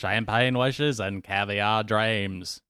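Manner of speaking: put-on slow, drawn-out Australian accent drifting toward New Zealand; wobbly Australian accent. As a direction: imitate a TV host in a shaky Australian-Kiwi accent. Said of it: "Australian? That was a little bit more like New Zealander".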